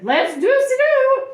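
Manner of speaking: very high, loud whoop; drawn-out excited cry; eager teacher. Acted. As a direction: sing out agreement, stretched and high.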